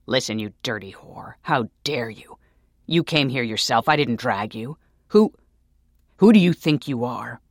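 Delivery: loud, rising rage; enraged man shouting back; furious. Acted. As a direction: spit it out in fury.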